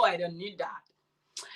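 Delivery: whispered aside, slow. Real, not acted. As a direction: drop to a breathy whisper as an aside.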